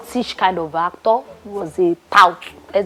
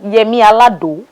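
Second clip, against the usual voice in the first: mocking English accent; 2 semitones higher, 6 dB louder than usual. Actress mocking host's English accent on "[name]". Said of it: "giving me that accent".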